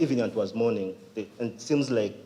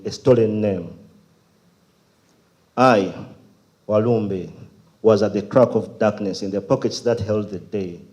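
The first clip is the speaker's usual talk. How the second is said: solemn, scripture-like spoken word; poem recitation; 6 dB louder than usual. Acted. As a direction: declaim in measured phrases with pauses.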